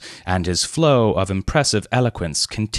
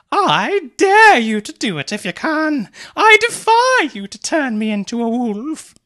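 high, louder taunting voice; provocative lady; 5 dB louder than usual. A narrator's reading. Mary daring Leonard from the conservatory, high taunt. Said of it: "laughed Mary provocatively".

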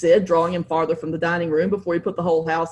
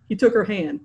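ordinary delivery, nothing marked

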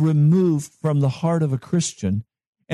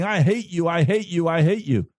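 mock child's tantrum; 3 semitones higher than usual. Preacher imitating a little child screaming at an adult, mild mock tantrum. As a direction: chant it like a protesting child, understated. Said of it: "screaming and saying".

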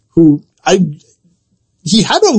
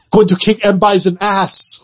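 mock Van Damme's Belgian accent through a laugh; Van Damme impression; 3 semitones higher than usual. Belgian-accented Van Damme line, laughing while mocking the movie to cohost. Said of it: "Jean-Claude Van Damme in the Street Fighter".

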